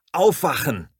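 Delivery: alarmed shout into the dark. Acted. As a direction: yell to wake the house. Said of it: "schreie ich".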